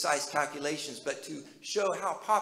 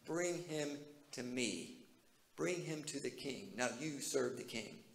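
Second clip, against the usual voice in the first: much softer, quiet firm command; softly commanding; 2 semitones lower, 9 dB quieter than usual. Retold or imitated. Jesus telling the oppressors to bring the man, quiet and firm. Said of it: "He's telling them".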